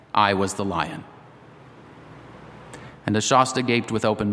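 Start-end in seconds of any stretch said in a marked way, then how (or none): 0.1-1.0 lightly voiced lion, low and solemn; a hint of a deep, grave voice; large lion voice. A narrator's reading. The lion reveals himself to the boy, understated deep voice. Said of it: "said the voice".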